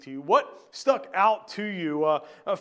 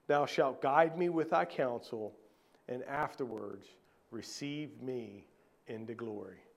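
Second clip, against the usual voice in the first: softer and lower, fading to a hush; scripture read; 7 semitones lower, 8 dB quieter than usual. Acted. Pastor reciting a psalm to the church, softening to a hush. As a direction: let the verse sink into a hush.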